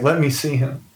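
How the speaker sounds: mild tearful pleading; crying plea. Acted. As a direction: beg as if crying, understated. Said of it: "She's crying".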